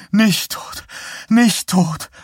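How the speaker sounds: frantic mother begging to know, voice shooting up high; shrill panicked mother. Acted. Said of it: "rief sie".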